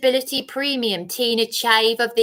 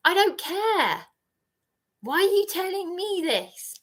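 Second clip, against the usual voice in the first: exasperated; 7 semitones higher than usual. Real, not acted. Exasperated dismissal.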